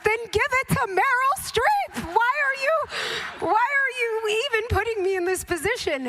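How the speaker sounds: high-pitched comic wail of protest; exasperated protest. Retold or imitated. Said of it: "I was like".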